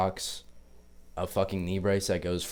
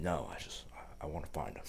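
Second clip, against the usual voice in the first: hushed whisper; 2 semitones lower, 9 dB quieter than usual. Retold or imitated. Hurt, answering uncle's joke on shrooms.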